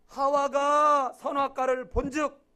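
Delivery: slow, loud proclaiming; preacher booming a scripture line at the congregation; preaching shout. Acted. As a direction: draw it out and shout it to the church.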